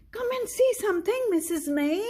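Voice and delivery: put-on high, fast, excited little girl calling out; eager child. Anina calls Missus May to see the junk, high eager child voice. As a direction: call the teacher over eagerly, voice way up.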